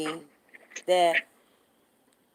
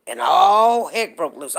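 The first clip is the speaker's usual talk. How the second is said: ordinary delivery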